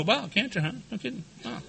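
very soft, offhand feigned calm; feigned cool. Retold or imitated. Patient hiding panic from his doctor, quietly nonchalant. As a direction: play it cool, barely audible. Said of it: "But the outside, I'm cool".

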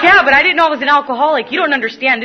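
loud, high, hurried mock outrage; hammed-up, high-pitched protest; indignant protest. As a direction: wail it fast over the laughter. Acting out protest to laughing rehab peers.

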